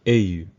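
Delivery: au said as a single open "oh"; Creole au. Shown as a demonstration. Teacher modelling her preferred word for where, clear. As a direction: give au as one clean vowel. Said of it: "That's how we say where in Louisiana Creole".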